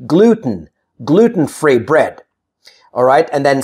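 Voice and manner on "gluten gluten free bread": gluten as gloot-n; vowel-less ending. Shown as a demonstration. Teacher modelling natural speech, gluten with bare N ending. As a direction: drop the vowel in gluten, T straight into N. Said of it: "I say gluten".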